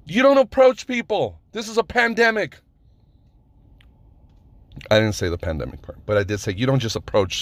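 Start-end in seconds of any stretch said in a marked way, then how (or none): 0.0-2.6 high-pitched re-enacted scolding; pissed off. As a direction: scold the stranger as if still angry. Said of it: "At this point, I was pissed".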